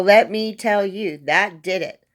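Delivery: plain reading voice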